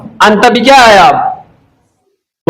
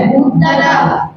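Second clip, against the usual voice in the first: soft drawn-out answer; 4 semitones higher, 6 dB quieter, much slower than usual. Shown as a demonstration. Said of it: "क्या है?".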